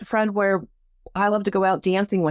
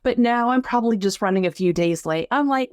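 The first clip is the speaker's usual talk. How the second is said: ordinary talk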